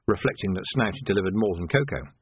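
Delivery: ordinary delivery, nothing marked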